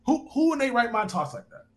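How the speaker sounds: ordinary talk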